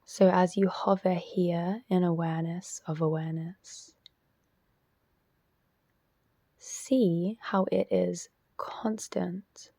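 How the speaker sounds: measured, spacious delivery, leaving silence after each instruction; guided meditation. Real, not acted. Teacher guiding listeners into self-inquiry, guided meditation voice.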